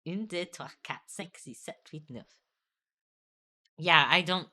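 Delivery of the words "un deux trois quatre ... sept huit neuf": counting "Un, deux" softly in awkward French, fading out; embarrassed French counting; trying French accent. Shown as a demonstration. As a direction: mumble the French numbers self-consciously. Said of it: "I swear I'm trying".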